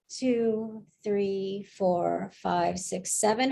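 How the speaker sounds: slow, evenly spaced soothing count; calming breath count. Real, not acted. Host guides panel through a deep breath, calmly. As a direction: count a slow inhale for them.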